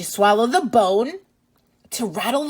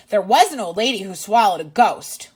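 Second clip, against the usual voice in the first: lively, louder read-aloud voice; reading to children; 2 semitones lower, 5 dB louder, faster than usual. Acted. Teacher reading a picture book to kids, lively and loud. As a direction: read it out big for little listeners.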